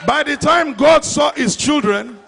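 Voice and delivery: normal talking voice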